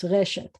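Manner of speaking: reshet corrected to RE-shet; stress on first syllable. Shown as a demonstration. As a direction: put the weight back on RE in reshet. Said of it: "always have the accent on the first syllable".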